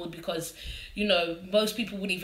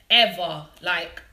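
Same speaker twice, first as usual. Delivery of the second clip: ordinary talk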